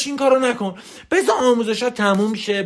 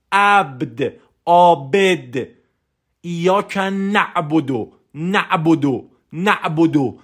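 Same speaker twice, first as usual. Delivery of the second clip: slow, low devotional recitation; weighty, drawn-out words of worship; deep, focused prayer; 3 semitones lower, much slower than usual. Shown as a demonstration. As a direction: pray each word with full concentration.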